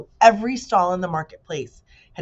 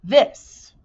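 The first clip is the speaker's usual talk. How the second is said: disdainful bee; 5 semitones higher, 9 dB louder than usual. A narrator's reading. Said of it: "said the bee with complete disdain".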